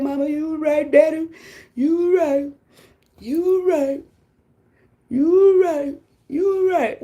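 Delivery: mock the grown kid coming back home; sheepish grown child. Returning adult child telling mama and daddy they were right.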